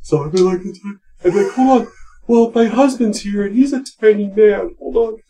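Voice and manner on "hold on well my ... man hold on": high-pitched woman's voice. Retold or imitated. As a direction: pitch it way up like a lady. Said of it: "and do my voice high".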